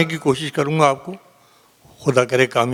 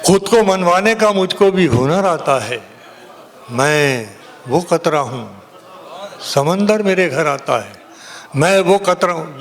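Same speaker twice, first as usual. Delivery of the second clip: ringing, declaimed Urdu ghazal; poetry recitation; 4 semitones higher than usual. Acted. Poet reciting a ghazal to a cheering audience, declaimed. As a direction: declaim the verse in sweeping phrases, pausing for effect.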